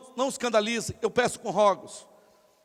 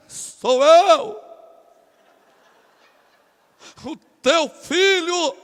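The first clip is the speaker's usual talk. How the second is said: loud, high, overblown announcement; hammed-up solemn voice, words stretched out; changed prayer intonation; 5 semitones higher, 8 dB louder, much slower than usual. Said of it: "tu muda a entonação da tua voz". (voz solene exagerada, alta e arrastada)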